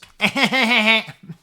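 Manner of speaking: big high loud cackling laugh; mother's unrestrained loud laugh; imitating mom's laugh. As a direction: burst out laughing like his mother. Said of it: "She laughed like this".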